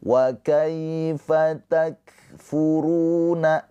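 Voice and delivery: Wa kaifa takfuruna drawn out, kaf with a breath release; slow tajwid recitation. Shown as a demonstration. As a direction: recite slowly, letting air out on the kaf. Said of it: "Kaf sukun mempunyai sifat hamas".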